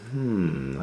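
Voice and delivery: plain reading voice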